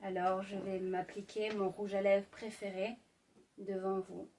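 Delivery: seduce the listener gently; sultry narrator. Acted. Intimate ASMR roleplay, lipstick.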